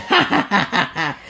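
high hammed-up cackle; mock evil laugh. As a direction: cackle like a gloating kid. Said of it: "I was laughing like".

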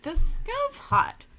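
put-on high, slow valley girl voice. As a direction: swoon it like a gushing girl. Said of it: "you almost went into your, your girl voice there".

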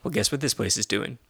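usual speaking voice

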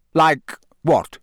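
sharp, quick demand; sharply. A narrator's reading. Moody cutting Harry off, asking sharply. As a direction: snap the question back. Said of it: "said Moody sharply".

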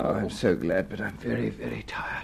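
tired breathy whisper; exhausted whisper. Acted. Exhausted medium after trance.

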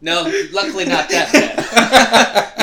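words pushed out through a big laugh; laughing protest. Real, not acted. Laughing off the cohost's cheeky guess, denying through laughter. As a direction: protest while cracking up.

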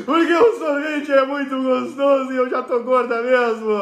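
a dieting woman talking herself into ice cream; mock whiny ice cream craver; whiny dieter. Dieting woman voiced in example.

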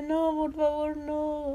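high, drawn-out mock-crying plea; tearful pleading. Caught shoplifter begging guards while crying, high mock-tearful plea. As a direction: wail it like a hammed-up sob. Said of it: "Yo estaba llorando".

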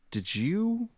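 a hint of a high suspicious waitress; lightly voiced waitress, suspicious; mock accusing waitress. Waitress confronting the writer, lightly voiced suspicion.

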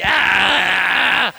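long mock bawling cry; hammed-up crying noise; baby-like wail. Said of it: "like a baby".

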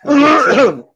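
second choking cough. Real, not acted. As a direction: cough to clear the throat. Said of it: "Me engasguei aqui".

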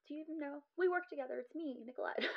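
high, soft mock incredulity; high-pitched retold quip. Patient answering a doctor she works with, high mock incredulity. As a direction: pitch it well up and keep it light.

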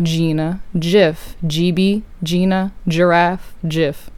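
"gif" like "jif", matching "Gina" and "giraffe"; soft G. Shown as a demonstration. Proving the point from her name, soft G in "gif". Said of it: "my name is Gina with a G".

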